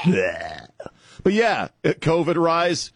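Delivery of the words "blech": short fake gag, falling; mock retching blech. Host mocking a politician, fake gagging sound. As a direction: pretend to throw up in one burst. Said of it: "If you really need to lose your lunch".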